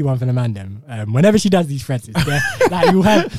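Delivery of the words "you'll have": normal talking voice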